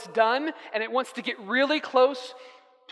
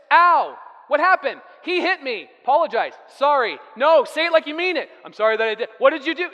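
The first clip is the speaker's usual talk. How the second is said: acted sibling squabble; 3 semitones higher, 6 dB louder than usual. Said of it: "Usually it goes like this".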